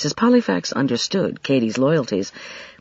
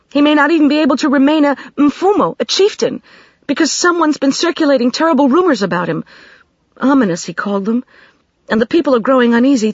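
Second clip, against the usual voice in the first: put-on high, louder, upset young Katie; agitated young woman; 11 semitones higher, 5 dB louder than usual. A narrator's reading. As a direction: pour out the worry, pitched high. Katie keeps talking.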